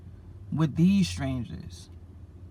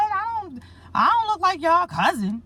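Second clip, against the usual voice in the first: high, louder disbelieving protest; baffled protest; 13 semitones higher, 4 dB louder than usual. Retold or imitated. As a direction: push back in confused disbelief, pitched well up. His own baffled reply retold.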